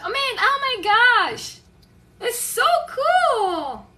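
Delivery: high, slow, long-drawn gushing; delighted awe. Acted. Reacting to someone turning up to help, delighted. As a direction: savour every word with delight.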